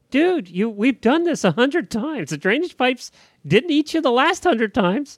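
high-pitched, talking down to a silly horse; mock exasperated scolding. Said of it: "I was like".

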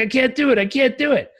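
high, mock-distressed protest; imitating near-crying. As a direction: plead as if almost in tears. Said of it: "like, almost crying".

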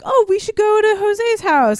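high, loud, stretched-out suggestion; gushing mock enthusiasm; eager friend.

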